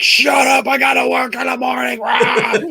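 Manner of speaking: high-pitched mock shout of an irate grandpa; grandpa yelling. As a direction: holler it like a fed-up grandpa. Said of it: "grandpa Leon keeps fucking yelling at us".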